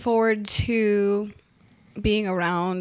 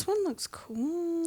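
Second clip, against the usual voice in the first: quiet; 7 semitones higher, 10 dB quieter, slower than usual. Real, not acted. Looking at something cool.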